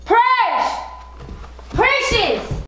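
high, drawn-out call for someone. Acted. Sister summoning her sister, calling out. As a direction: summon her from across the house.

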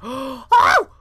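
sudden high loud gasp of fright; jolted by a jump scare; startled gasp. Real, not acted. Jump scare gasp in horror game.

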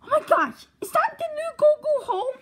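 high, thrilled gasping delight; excited. Acted. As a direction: squeal over a new gadget. Sees the new gadget.

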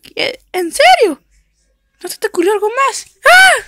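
high, fast, indignant yelps; a little dog that can't believe it; outraged dog voice. Acted. Dog complains about its name, high and indignant.

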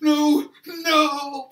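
very high, loud scream of no; horrified driver. Acted. Truck driver about to hit lady, screamed horror. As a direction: cry out in helpless horror.

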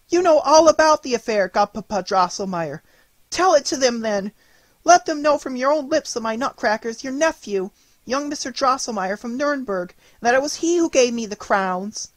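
put-on a child begging her godfather to tell; crying out. A narrator's reading.

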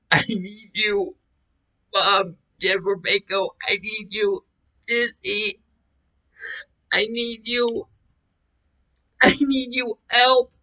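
high, slow pleading wail; tearful plea. Retold or imitated. Begging mom, Jennifer and Sissy for help, tearful plea. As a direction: beg desperately, pitched high.

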